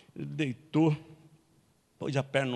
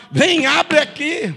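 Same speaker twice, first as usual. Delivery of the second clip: put-on much higher, loud, slow drunken shout; drunk demand; 12 dB louder, slower than usual. Drunk husband ordering wife to open up, loud. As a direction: drag the words like a drunk yelling.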